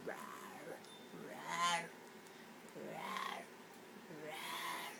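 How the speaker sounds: breathy hushed little growls; quiet growl. Pretend. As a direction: growl softly, almost whispered. Growling softly at pet bird.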